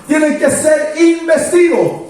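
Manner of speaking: high, ringing pulpit voice that drives each phrase home; preaching at full force. Acted. Pastor exhorts his church to wait for power, fervent preaching. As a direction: proclaim it to the congregation with fervour. Preacher raises pitch, emphatic.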